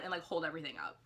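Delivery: ordinary talk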